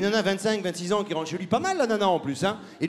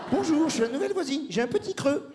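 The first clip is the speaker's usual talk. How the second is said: much higher, playful woman's voice; mock neighbor; 8 semitones higher than usual. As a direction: play the neighbor in the ad. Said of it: "Alors, on fait". (voisine imitée, voix très aiguë)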